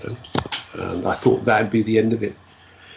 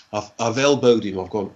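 ordinary talk, nothing marked

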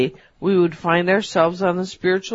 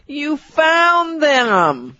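hammed-up Scottish brogue, stretched long and high; 8 semitones higher, much slower than usual. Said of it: "with a heavy Scottish brogue".

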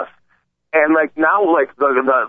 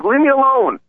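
put-on higher, pleading old-man plea; 9 semitones higher than usual. Host imitating the old man on the bus, higher pleading voice. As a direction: beg to be left alone like a scared old man. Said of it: "the guy's like".

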